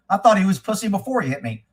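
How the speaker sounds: ordinary talk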